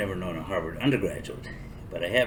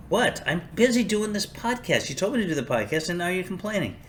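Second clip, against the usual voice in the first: annoyed reply off-mic; 10 semitones higher than usual. Real, not acted. Answering someone off-mic mid-podcast.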